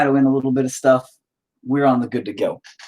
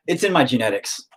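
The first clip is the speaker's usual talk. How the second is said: ordinary talk, nothing marked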